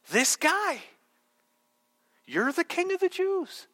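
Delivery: high-pitched, incredulous rhetorical questions; condescending Pilate. Acted. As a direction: look down on him in disbelief. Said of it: "Notice Pilate's condescending tone".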